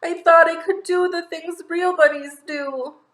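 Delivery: high, loud, slow wail; a fake bunny confessing the truth in tears. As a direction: wail it out, heartbroken. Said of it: "He wails".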